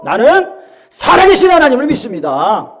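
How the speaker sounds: high, forceful declaration of faith; bold proclamation. Shown as a demonstration. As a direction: proclaim it loudly to the congregation. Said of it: "우리 선포합시다". (크고 단호하게 선포하는 말투)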